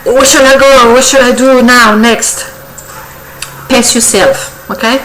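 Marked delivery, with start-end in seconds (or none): 0.0-2.4 higher, eager rush of questions; excited. Acted. Tarot reader imitating an eager client, excited and higher-pitched. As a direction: play someone impatient for the next move. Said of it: "you're very excited, like".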